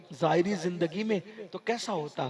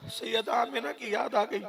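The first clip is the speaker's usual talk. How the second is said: ordinary talk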